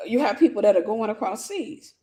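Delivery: usual speaking voice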